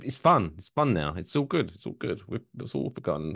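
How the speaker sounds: ordinary delivery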